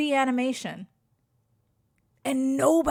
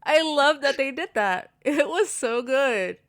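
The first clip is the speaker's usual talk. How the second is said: laughing; 4 semitones higher, 4 dB louder than usual. Real, not acted. Gushing about the movie's meme.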